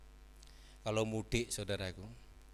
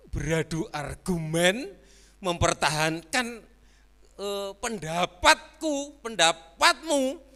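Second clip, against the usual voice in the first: higher and louder, slow, mock quarrel; heated mock arguing, hammed-up; mock argument; 7 semitones higher, 10 dB louder, slower than usual. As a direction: act out two people bickering.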